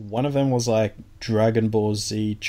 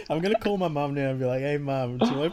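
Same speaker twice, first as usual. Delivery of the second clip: laughing; 4 semitones higher, much faster than usual. Real, not acted. Joking about calling his mum.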